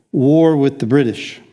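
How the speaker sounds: ordinary talk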